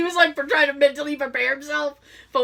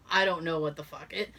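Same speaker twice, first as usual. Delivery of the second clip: ordinary talk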